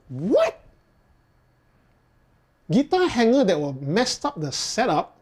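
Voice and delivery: can't believe the question; incredulous. Real, not acted. Reacting to odd viewer question.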